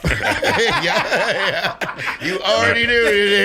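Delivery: breathy, laughing loud and high. Real, not acted. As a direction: crack up while saying it. Laughs at self-hating voices.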